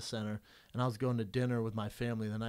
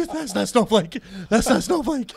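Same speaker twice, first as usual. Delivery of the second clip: higher and much louder, half laughing; shocked disbelief; 9 semitones higher, 14 dB louder than usual. Retold or imitated. Fighter realizing his choke failed, loud high disbelief. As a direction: cry out in stunned disbelief.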